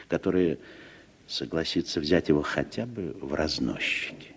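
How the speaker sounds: ordinary delivery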